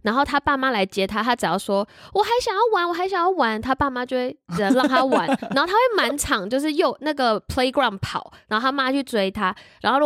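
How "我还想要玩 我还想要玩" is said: higher, mildly imitated whining kid; a light imitation of a pleading child; child begging his parents for more playtime; whiny child.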